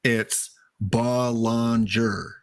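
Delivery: Belanger drawn out slowly, bel-an-jer; slow careful pronunciation. Shown as a demonstration. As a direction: spell out Belanger syllable by syllable, slowly. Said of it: "We have confirmation".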